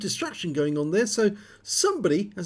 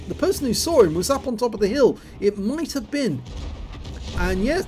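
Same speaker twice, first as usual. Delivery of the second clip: normal talking voice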